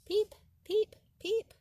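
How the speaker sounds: high, loud piping peeps; high frog peep. Acted. The spring peeper calling in the woods, high and loud. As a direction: chirp it like a tiny frog.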